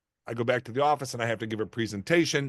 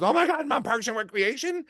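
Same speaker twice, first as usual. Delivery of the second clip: high, thrilled retold squeal; excited daughter; 14 semitones higher than usual. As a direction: imitate an amazed daughter, pitched way up.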